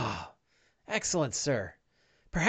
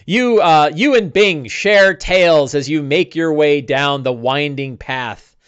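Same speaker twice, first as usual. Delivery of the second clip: ordinary delivery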